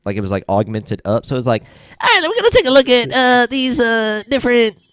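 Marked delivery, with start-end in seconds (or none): 1.6-4.8 very high, chipmunk-like narrator voice; squeaky high voice. Retold or imitated. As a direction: pitch it way up like a sped-up recording. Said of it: "like, a high-pitched squeaky voice".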